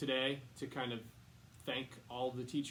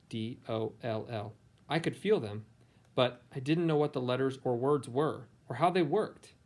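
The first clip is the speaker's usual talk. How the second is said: clear, louder storybook reading voice; reading to children; 10 dB louder than usual. A narrator's reading. Teacher reading a picture book to her students, storybook voice. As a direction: read it aloud to a class of kids.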